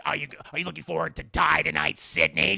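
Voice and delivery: loud, menacing taunt; hammed-up loud killer taunt; menacing killer message.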